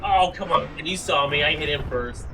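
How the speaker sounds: loud frustrated gamer complaint; raging gamer. Real, not acted. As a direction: yell at the game in protest. Complaining about a lost kill.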